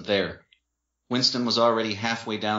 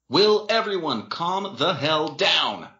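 slow, high, exasperated yell; 8 semitones higher, slower than usual. A narrator's reading. Boyfriend silencing the quarrel, yelling. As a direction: draw out each word to command the room. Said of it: "Dean yelled".

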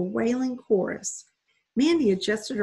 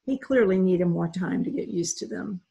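plain reading voice

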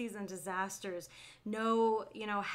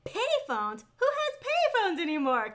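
high, loud disbelieving voice; incredulous; 9 semitones higher, 5 dB louder than usual. Retold or imitated. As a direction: scoff at the idea of payphones. Voicing a doubting viewer.

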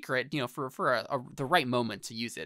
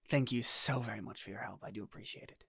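soft, courteous thanks trailing off; lightly voiced, hushed gratitude; Chalmers in character; 2 semitones lower, 5 dB quieter than usual.

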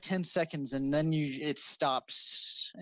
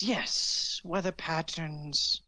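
put-on elderly wizard; 4 semitones higher, slower than usual.

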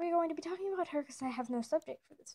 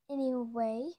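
ordinary talk, nothing marked

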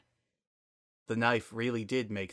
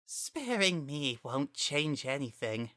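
higher, soft, slow smug voice; smirking flower; 4 semitones higher, 4 dB quieter, slower than usual. Acted. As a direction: let the smirk show through. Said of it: "hear the smirk in that voice".

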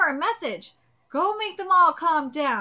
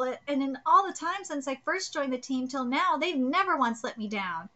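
ordinary delivery

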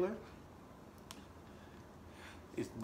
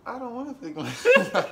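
higher and much louder, laughing through it; mock-whine the refusal, cracking up; laughing protest about taking shoes off; whiny refusal; 10 semitones higher, 12 dB louder than usual. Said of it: "I say".

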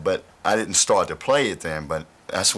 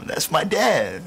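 mock thrilled kid, pitched much higher; high, delighted boyhood exclamation, acted out; excited child; 11 semitones higher, slower than usual. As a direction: play an excited little kid, voice jumping up. Child proudly hearing father on radio.

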